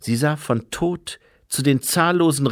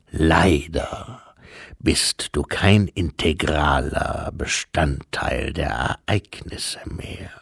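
patient, ponderous bearer of bad news; solemn Death; 7 semitones lower than usual. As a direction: speak gravely and deep, with breathy line endings. Voices Death explaining doctrine.

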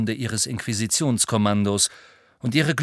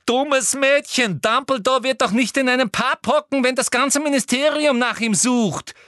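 high and louder, disappointed Umbridge; exclaiming; 5 dB louder than usual. A narrator's reading. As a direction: scold the foolish girl. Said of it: "rief Umbridge". (Umbridge ruft enttäuscht)

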